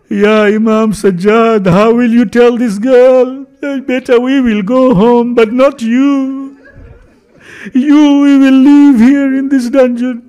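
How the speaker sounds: slow, high, cruel taunting jailer; mocking jailer. Jailer jeering at Imam Sajjad over the little girl, slow and high. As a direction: drag the words out to jeer at the prisoner.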